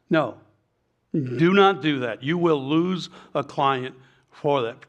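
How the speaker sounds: slow, emphatic warning; stern warning. Real, not acted. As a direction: drive each word home slowly. Sternly warns captains against fishing.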